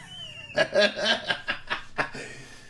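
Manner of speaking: airy giggle up high; high breathy laugh. Real, not acted. Reader cracking up at his own review, breathy laugh.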